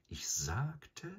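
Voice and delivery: breathy whisper, high rise. A narrator's reading. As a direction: hush it, rising at the end. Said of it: "wiederholte Bagheera".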